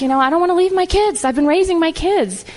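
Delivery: upset husband protesting to the mentor. Retold or imitated. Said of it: "was pretty upset 'cause he's like".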